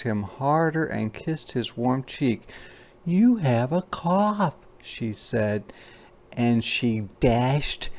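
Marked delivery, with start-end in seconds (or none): 3.0-4.6 mama bear voice, higher and slow; gentle mama bear. Acted. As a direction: soothe the sick cub as his mother. Mama Bear's voice in picture book.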